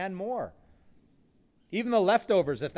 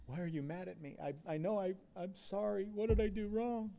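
softer, higher, timid apology; a frightened follower pleading, much softer and higher; scared disciple; 6 semitones higher, 5 dB quieter than usual. Acted. Voicing a guilty, scared disciple.

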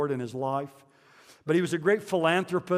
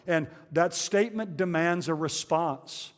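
usual speaking voice

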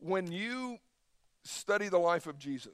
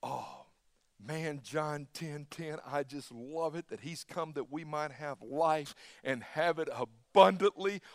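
normal talking voice

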